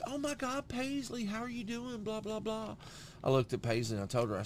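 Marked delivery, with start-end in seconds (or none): 0.1-2.8 very high sing-out greeting; imitated high-pitched fussing; gushing cousin. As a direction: coo excitedly at a little kid.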